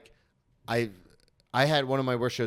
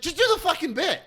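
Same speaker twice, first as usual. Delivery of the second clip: much higher, louder self-scolding; frustrated outburst; 5 dB louder than usual. Retold or imitated. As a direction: yell at yourself to get on with it. Inner voice scolding himself.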